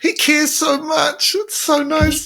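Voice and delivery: high, slow and tender; a host moved by a sweet story about a son, high and tender; touched. Real, not acted. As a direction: melt at the sweetness.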